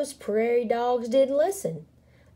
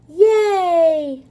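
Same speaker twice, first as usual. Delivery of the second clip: loud, high, drawn-out cheer; 10 semitones higher, 9 dB louder than usual. A narrator's reading. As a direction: cheer like a whole crowd. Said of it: "the crowd cheered".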